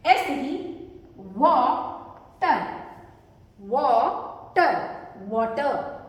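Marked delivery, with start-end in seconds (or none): 1.4-2.6 water very slow, वा held, pause, then टर; split syllables. Shown as a demonstration. Teacher sounding out syllables for pupils, split syllables. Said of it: "इसे भी जब आप जोड़कर बना रहे हैं". 3.6-4.8 वाटर stretched into वा, pause, टर; split syllables. Shown as a demonstration. Teacher repeating the parts for pupils, split syllables. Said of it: "इसे भी जब आप जोड़कर बना रहे हैं".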